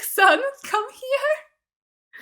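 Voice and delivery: very high, very slow, tearful mock dad; mock fatherly Giles. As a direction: ham up a weepy father calling his son. Voicing Giles hugging Spike.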